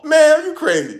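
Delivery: high, loud disbelief; are you serious, loud and high; incredulous. Real, not acted. Reading a comment about Clemson, a loud incredulous yelp.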